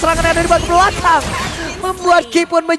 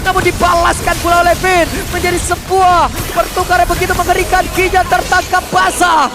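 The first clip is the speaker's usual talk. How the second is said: raised pitch, rising play-by-play hype; fighting game announcer; 5 semitones higher than usual. Real, not acted. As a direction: call the fight like an esports caster. Caster hyping a team fight. (komentator esports yang bersemangat)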